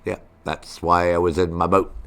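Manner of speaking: ordinary talk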